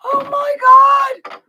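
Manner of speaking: very high, squealed gasp of disbelief; hammed-up shriek after a gasp; mock shock. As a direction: act out a horrified discovery at the top of your range.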